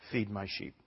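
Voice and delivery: low, soft and unemotional; same even tone. Acted. Jesus answering Peter without comment, quiet and simple. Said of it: "in the same tone of voice what he said before".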